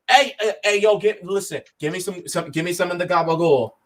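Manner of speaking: a hint of a New York mobster accent, fast; lightly hammed-up Italian-American mobster accent; bad mobster accent. Teammate rallying the squad to the chopper in a bad mobster accent. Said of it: "that was probably the worst mobster accent".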